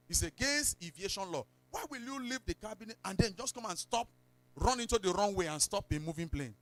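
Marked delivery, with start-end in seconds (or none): none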